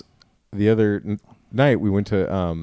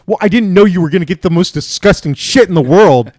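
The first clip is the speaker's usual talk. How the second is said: higher and louder, indignant outburst; mock angry wife; 7 semitones higher, 11 dB louder than usual. Retelling his wife's fury over dessert, high and loud. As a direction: throw it back at him like an outraged wife.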